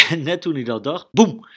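boem, shouted out high and loud; loud boom. A narrator's reading. Narrator telling the kids about the big kick, loud boom. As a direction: burst out with the sudden boom.

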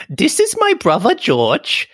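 put-on high, drawn-out British kid voice; slow mock-British cartoon child; British accent impression. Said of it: "wanting to do a British accent".

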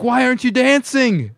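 high, loud mock girl voice; imitated girl's urging. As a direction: call it out like a girl egging him on. Voices girl urging friend to dance.

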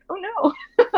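high, half-crying oh no; startled oh no. Retold or imitated. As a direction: squeal it in mock alarm. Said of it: "I was like".

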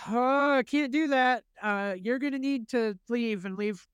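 high, polite but firm; telling the attacker he must leave the woman alone; nervous refusal. Retold or imitated. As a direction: stand your ground nervously. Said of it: "And I go".

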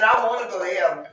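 rushed, tossed-off statement; quick aside. Real, not acted. Preacher telling the church quickly, fast and offhand. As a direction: say it fast in passing.

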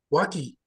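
Waki said softly, "WAH-kee" with hard k; misread pronunciation. Shown as a demonstration. Repeating his spelling-based reading, Waki with hard k. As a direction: drop it low, Waki ending in "kee". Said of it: "I look at that, I say".

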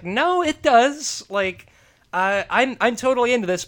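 high, loud nerd's objection; mock protest. Nerd refusing the entranced girl's advances, mock protest.

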